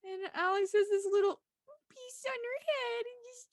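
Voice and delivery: high, choked-up gushing; tearing up. Real, not acted. Fan describing Alex's wedding look, real tearing up. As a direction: let it climb as if about to cry. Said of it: "I'm gonna cry. I'm, like, tearing up".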